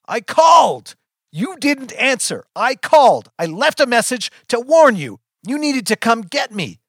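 high, loud, accusing outburst; screamed reproach. A narrator's reading. Child reproaches mother for not answering, screaming. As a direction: hurl each complaint at full pitch.

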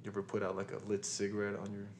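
ordinary talk, nothing marked

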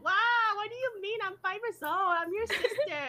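put-on high, playful objection to a child; mock protest. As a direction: protest in a high voice, half laughing. Retold protest to little girl.

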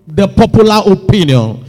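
forceful preaching. Real, not acted. Preacher urges believers not to follow popular opinion, forceful exhortation. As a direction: drive each charge home from the pulpit.

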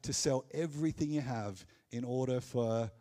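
ordinary talk

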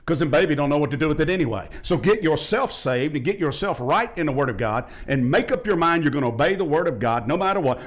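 ordinary delivery

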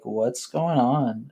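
slow concerned question. Retold or imitated. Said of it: "people were like".

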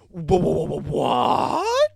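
high, loud, stuttered what, slowing down; stammering disbelief. Retold or imitated. Hearing the dose, he stammers in shocked disbelief. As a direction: choke out the question in shock.

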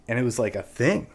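normal talking voice

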